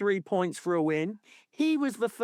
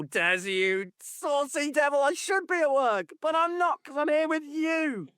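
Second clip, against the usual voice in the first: flirty TV guest; 11 semitones higher than usual. Retold or imitated. Presenter's guest teasing Des, high mock flirt. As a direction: coo it like a cheeky flirt.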